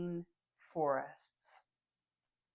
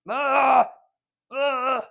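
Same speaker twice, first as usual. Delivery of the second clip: loud teary "ugh" of exasperation; real exasperated, teary groan; loud frustrated groan; 5 semitones higher, 14 dB louder than usual. Real, not acted. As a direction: groan out loud at the ruined mascara. Mascara running from crying.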